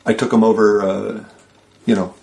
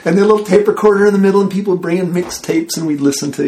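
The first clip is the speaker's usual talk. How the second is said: excited; 9 semitones higher than usual. Real, not acted. Happy recollection, animated.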